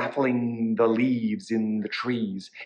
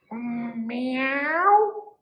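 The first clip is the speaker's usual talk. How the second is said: long, high rising meow; little cat; 11 semitones higher than usual. A narrator's reading. Cat objects to the witch, long high meow. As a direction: stretch the meow upward. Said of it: "the little cat said".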